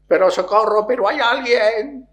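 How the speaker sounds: high, loud plea for help; desperate shout. Retold or imitated.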